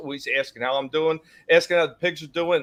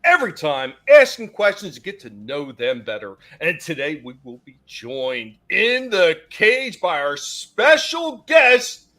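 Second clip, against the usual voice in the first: drawn-out announcer welcome with punched words; show host intro; 7 dB louder than usual. Acted. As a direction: announce the special guest, stretching key words. Hosts the show's opening.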